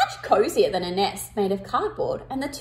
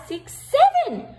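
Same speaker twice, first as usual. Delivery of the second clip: surprised; 4 dB louder than usual. Acted. Miscounting, surprised at seven.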